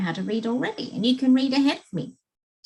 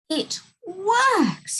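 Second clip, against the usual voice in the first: wonderment; 9 semitones higher than usual. A narrator's reading. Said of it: "in wonderment".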